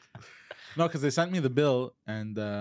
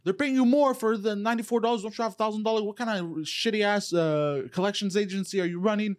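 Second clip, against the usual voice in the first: retold rant; 9 semitones higher than usual. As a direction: tear into the collector. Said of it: "And I just went off".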